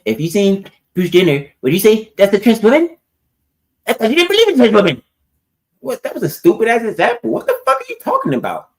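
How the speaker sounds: high, loud outraged rant; heated yelling. Real, not acted. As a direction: shout it in disbelief. Heated argument over bad example.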